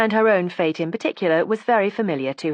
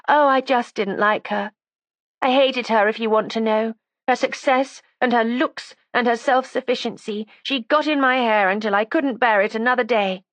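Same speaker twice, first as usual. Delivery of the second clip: put-on high, defiant young woman; resentful young woman; 7 semitones higher than usual. A narrator's reading. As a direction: voice her as a sulky, stubborn girl. Judy's voice again.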